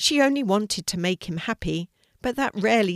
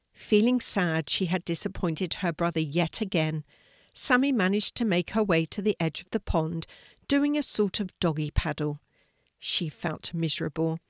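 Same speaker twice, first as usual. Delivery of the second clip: usual speaking voice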